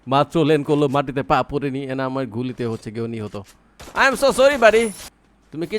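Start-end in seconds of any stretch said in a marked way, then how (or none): 3.9-4.9 higher, louder fake sorry; mock apology. Streamer taunts the enemy he shot, mock sorry. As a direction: sing out an insincere apology.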